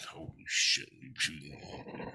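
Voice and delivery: hushed shock. Retold or imitated. Retold shock at woman's stare.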